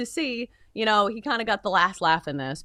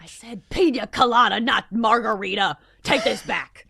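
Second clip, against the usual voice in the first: a touch of an entitled customer, a bit louder; lightly voiced demanding customer sending a drink back; mock diner complaint; 4 dB louder than usual. Coach berating a waiter, imagined.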